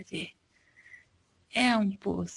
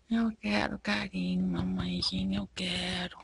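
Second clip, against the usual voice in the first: soft begging that fades to a whisper; pleading whisper; 3 semitones lower, 7 dB quieter than usual. Pretend. Dog voiced by mom begging for affection, fading to a whisper. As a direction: beg softly for affection, trailing off.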